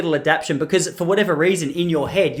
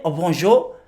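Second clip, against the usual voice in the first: light French-accented greeting; polite attempt at a French accent; slower than usual. Shown as a demonstration. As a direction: greet a child with a touch of French. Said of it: "communicate with a little French kid in his accent".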